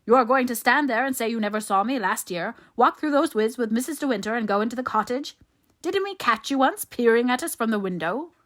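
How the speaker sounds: loud, high, incredulous tirade; angry badgering. A narrator's reading. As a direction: hound him with accusing questions. Favell angrily badgers Ben.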